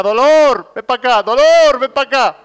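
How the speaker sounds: hollering for her son across the house; mother calling her child, hammed up. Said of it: "cada vez que lo llamaba su madre decía".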